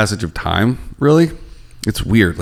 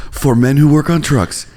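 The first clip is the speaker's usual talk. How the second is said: perfume ad voice; 5 semitones higher than usual. Pretend. Continuing mock perfume ad.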